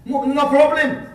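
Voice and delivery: high, bright reassuring voice; soothing a worried patient. Retold or imitated. Reassuring voice in the retold scene.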